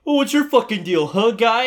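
high, loud taunting jeer; mock bratty schoolkid. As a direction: needle him like a smug twelve-year-old. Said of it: "middle schoolers are out here like".

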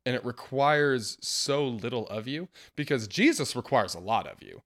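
ordinary talk

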